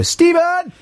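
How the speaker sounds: high, sustained public call; shouted catchphrase. Shown as a demonstration. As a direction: call the name high, as if hailing a stranger. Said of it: "shout to each other in public spaces".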